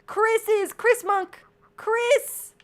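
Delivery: real happy shouting; excited calling. Real, not acted. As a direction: call out their names in joy. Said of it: "sorry if I woke you up with my screaming".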